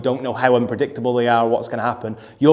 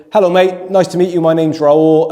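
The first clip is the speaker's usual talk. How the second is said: higher, louder beaming hello; warm friendly greeting; 4 semitones higher, 5 dB louder than usual. Retold or imitated. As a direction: greet him with kindness. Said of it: "nice warm handshake".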